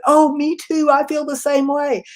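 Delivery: high, loud burst of relief; relieved chorus. Retold or imitated. Board members relieved that someone admitted confusion, a high loud burst. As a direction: blurt it out, glad someone finally said it.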